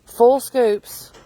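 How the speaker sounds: ordinary talk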